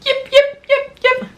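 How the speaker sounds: very high squeaky puppy yips; high mock barking for kids. Shown as a demonstration. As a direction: yip like a tiny puppy. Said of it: "Puppies bark".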